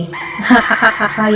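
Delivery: eerie ghost laughing; ghostly cackle. A narrator's reading. The dead Nila laughing at Vijay, eerie cackle. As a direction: cackle like a ghost who has him trapped. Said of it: "என பேசிருப்பு சிரிக்க".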